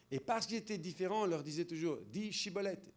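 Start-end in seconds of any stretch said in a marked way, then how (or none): 2.3-2.9 "chibolette" opened with a clear ch, as in French "chat"; ch sound. Retold or imitated. Tribe demanding the password from outsiders, "chibolette" with ch. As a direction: say "chibolette" with a hushing sh at the start. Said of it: "le son che".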